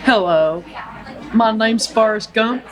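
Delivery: lightly Southern Forrest Gump introduction; a hint of Southern drawl as Forrest Gump; Forrest Gump accent. Forrest Gump greeting the nurse on the bench, light Southern accent. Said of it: "Do the accent".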